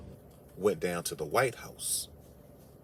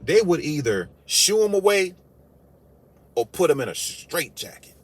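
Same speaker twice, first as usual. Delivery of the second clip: much higher and louder, hammering it home; loud punchline; 8 semitones higher, 7 dB louder than usual. Real, not acted. Comic to audience on Jesus visiting, loud higher punchline. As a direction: shout the punchline at them.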